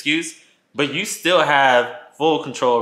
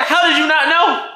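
laughing outraged; 4 dB louder than usual. Retold or imitated. Confronting cheater, laughing.

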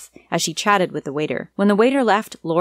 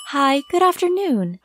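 bright, higher polite service greeting; customer service voice; 8 semitones higher than usual. A narrator's reading. Applicant at the intercom, polite customer service voice. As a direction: sound like a cheerful receptionist. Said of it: "I said in the best customer service voice".

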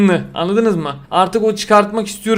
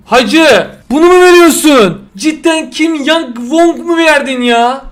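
loud, higher, indignant disbelief; exasperated outburst; 6 semitones higher, 5 dB louder, slower than usual. Real, not acted. Streamer yelling at the game over a bad pull, outraged. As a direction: yell at the game for cheating you. Angry at bad pack result.

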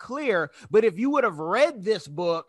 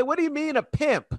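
high, incredulous question; mock confusion at the word pimp; puzzled question; 7 semitones higher than usual. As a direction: ask it as a confused newcomer. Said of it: "they're gonna say".

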